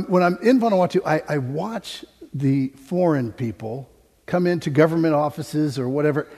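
ordinary talk

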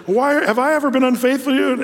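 playful high donkey voice; talking donkey. Retold or imitated. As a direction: plead up high as the wronged donkey. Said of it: "remember, the donkey says".

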